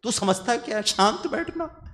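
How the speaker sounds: ऊँची आवाज़ में गुस्से से डाँटना, "शांत बैठना" धीमा और खींचकर; दोस्त पर झल्लाकर गुस्सा दिखाना; नकली गुस्से वाली डाँट. Retold or imitated. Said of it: "हो सकता है मैं उसको दो गाली दे दूं". (परेशान दोस्त को झिड़कते हुए ऊँची आवाज़ में गुस्सा)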